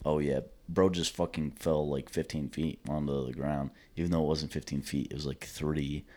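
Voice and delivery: low, quiet mock-casual retelling; dismissive imitation. Mimicking a friend's account of bro's fall, dismissively. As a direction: imitate his offhand attitude, soft and low. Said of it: "this is the exact attitude he had".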